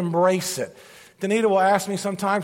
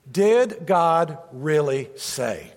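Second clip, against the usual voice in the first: slow, weighty, stress on Did; slow emphasis; 3 semitones lower, much slower than usual. Shown as a demonstration. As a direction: let each word land slowly. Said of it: "That's the big one right there".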